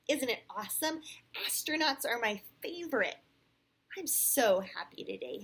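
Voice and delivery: put-on animated, excited little boy. A narrator's reading. Hudson shows off his astronaut costume to Sam, excited. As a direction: punch it with mild bubbling excitement. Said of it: "Hudson said excitedly".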